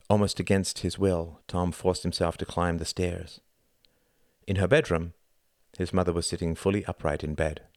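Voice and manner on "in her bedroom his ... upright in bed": ordinary delivery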